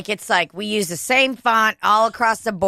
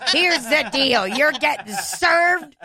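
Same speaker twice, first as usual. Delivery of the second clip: mock tough talk; 8 semitones higher than usual. Mock tough process server.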